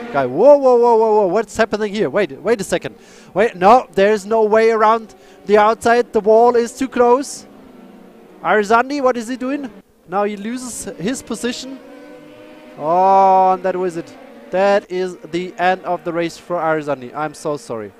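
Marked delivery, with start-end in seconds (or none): 0.1-7.5 much louder, higher live commentary; excited race call. Real, not acted. 8.4-17.1 higher, loud play-by-play; excited commentary. Real, not acted. Live crash call.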